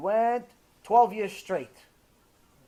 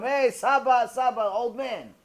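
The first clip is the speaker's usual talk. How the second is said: raised, mocking playground call; making fun; 4 semitones higher, 3 dB louder, slower than usual. Kindergarten children make fun of older classmate, mocking call. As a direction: tease him, dragging out the last words. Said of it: "They started making fun of him".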